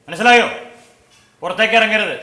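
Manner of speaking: stern order. Acted. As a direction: lay down the rule forcefully. Man gives stern orders. (കർശനമായി ഉറക്കെ)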